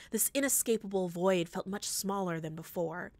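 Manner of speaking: ordinary delivery, nothing marked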